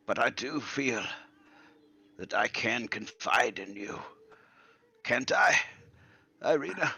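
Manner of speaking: a hint of a fond old gentleman; old man confiding. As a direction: confide haltingly, seeking trust.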